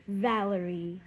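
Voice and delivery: plain reading voice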